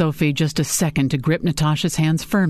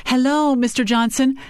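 higher, lightly projected greeting; projecting loudly; 8 semitones higher than usual. A narrator's reading. Sophie calls across the desk to the speakerphone, mildly loud. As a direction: make the voice carry across a desk. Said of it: "Sophie said loudly so her voice carried".

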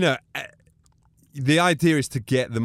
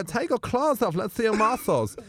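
high-pitched camp Austrian coaxing; 7 semitones higher than usual. Retold or imitated. Bruno talking to the wrestlers, high camp coaxing. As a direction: coax them in a high Austrian voice.

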